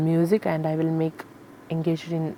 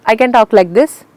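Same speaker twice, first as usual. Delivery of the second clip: pitched up and louder to show range; higher, louder range demo; 4 semitones higher, 11 dB louder than usual. Shown as a demonstration. Singer showing range to interviewer, higher and louder. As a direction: lift the voice and push it out. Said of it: "range means".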